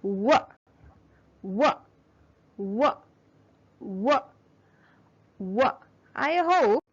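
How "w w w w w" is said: w said alone, lips rounded, again and again; repeated w sound. Shown as a demonstration. Teacher models w for children to repeat. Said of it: "practice saying the sound".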